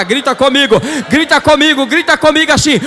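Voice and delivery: loud call rising in pitch; rallying preacher's shout. Real, not acted. Preacher asking the church to shout with him, rallying shout. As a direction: whip the crowd up to shout along.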